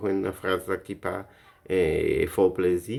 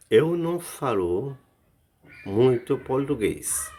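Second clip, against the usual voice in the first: emphatic model; 6 semitones higher than usual. Shown as a demonstration. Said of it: "teacher models phrase".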